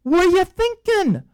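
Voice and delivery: high, slow, mock-outraged scolding. Jerusalem elders scold Peter, high mock outrage. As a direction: play the elders chewing him out. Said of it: "the guys call him on the carpet".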